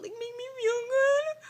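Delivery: very high, loud squeaky voice; cartoonish high whimper; silly squeak. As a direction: squeal it in a tiny mock voice. Silly voice describing her vitamins.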